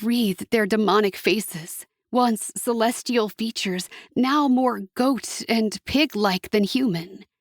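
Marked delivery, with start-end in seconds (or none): none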